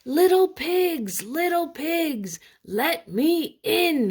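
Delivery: high, loud, slow wolf growl; hungry wolf growling outside. Acted. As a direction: bellow it at the brick door. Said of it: "he growled".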